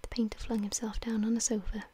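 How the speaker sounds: ordinary delivery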